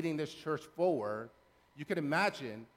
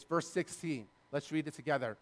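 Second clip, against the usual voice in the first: ordinary delivery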